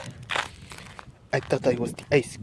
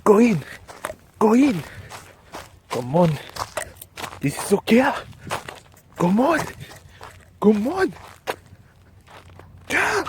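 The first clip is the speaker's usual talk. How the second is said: cheering; 8 semitones higher than usual. Pretend. Willing the pretend ball into the goal, excited cheering.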